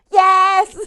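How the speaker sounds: very loud, high, drawn-out yell; shouted call. Retold or imitated. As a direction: holler the all-clear upstairs. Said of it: "person down here shouts".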